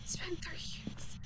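slow, hushed whisper; mock-haunted whisper. Calling out to someone missing for years, slow whisper. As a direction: whisper it slowly, barely any voice.

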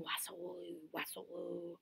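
very soft slithering noise; slithering sound. A narrator's reading. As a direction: murmur it like a snake gliding by. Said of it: "who came slithering".

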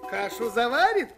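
usual speaking voice

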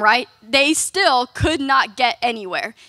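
ordinary delivery

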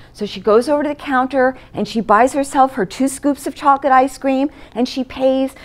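usual speaking voice

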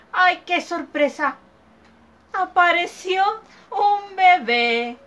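put-on storyteller revealing a baby; surprised reveal. A narrator's reading. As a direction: build up the big surprise for the kids.

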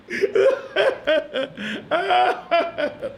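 a long, high laugh; high burst of laughter. Real, not acted. Preacher laughing at the king's sobbing, a high burst of laughter. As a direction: laugh it out freely.

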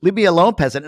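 high, loud, haughty dismissal; a mock-snob waving off a fan; snooty brush-off. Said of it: "I go and scare someone like".

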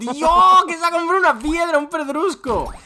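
high, loud, fast disbelieving yell; excited shout. Real, not acted. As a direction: shout in amazement at his friend. Friend buys a giant rock. (grito de asombro agudo y fuerte)

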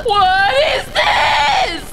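long drawn-out cry of disbelief; shocked shout. Acted. Guest sees who is at the party, shocked shout. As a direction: stretch each word in horrified shock.

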